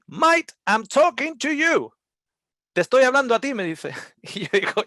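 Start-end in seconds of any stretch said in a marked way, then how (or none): 0.1-3.5 loud insistence. Retold or imitated. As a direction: insist hard to be heard. Customer insisting loudly.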